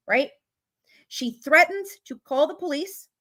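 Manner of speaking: usual speaking voice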